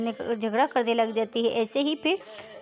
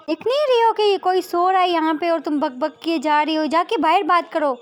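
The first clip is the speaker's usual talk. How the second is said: high, loud irritated scolding; raised voice, woken up and furious; angry outburst; 6 semitones higher, 6 dB louder than usual. Acted. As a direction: snap angrily at a noisy roommate. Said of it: "गुस्से में बोलती है".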